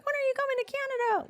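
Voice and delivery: high, fast squeaky fan voice; mock fan squeal; eager fans. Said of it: "everyone's like".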